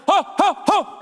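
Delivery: high, breath-punched "ho" bursts; imitated huffing noise. Storyteller mimicking the drunk man falling back, high huffs. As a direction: mimic a man being knocked backwards. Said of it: "all of a sudden I hear".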